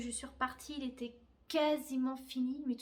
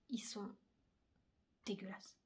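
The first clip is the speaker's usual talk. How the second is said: whispered disgust; 3 semitones lower, 9 dB quieter than usual. Real, not acted. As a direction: breathe "dégueulasses" in a toneless whisper. Confides her buttons look awful. (chuchoter « dégueulasses » avec dégoût)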